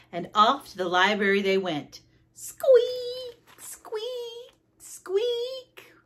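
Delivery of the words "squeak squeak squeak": very high, drawn-out squeaks; wheel squeak. A narrator's reading. Narrator imitates the wheelbarrow wheel, squeaking. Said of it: "The squeaking is the wheel".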